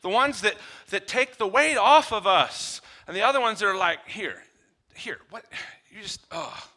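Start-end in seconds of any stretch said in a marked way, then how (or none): 4.1-6.7 scoffing. Retold or imitated. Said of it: "That scoff".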